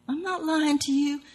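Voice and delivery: acted lover's defensive denial; protesting denial. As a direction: deny it with a lifted, pleading voice.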